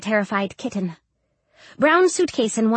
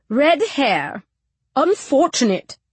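put-on slow, sniffy older woman; disapproving matron; 5 semitones higher, slower than usual. A narrator's reading. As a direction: tut over it like a stern matron. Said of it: "the plump woman beside her says".

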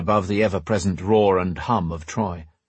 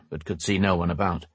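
ordinary delivery, nothing marked

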